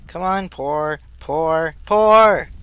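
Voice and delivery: rising from urging to a loud yell; building urging shout. Real, not acted. Urging molten metal to pour.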